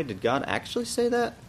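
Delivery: high, lilting question. Shown as a demonstration. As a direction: ask it as a genuine question, pitch rising high. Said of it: "more in a questioning way".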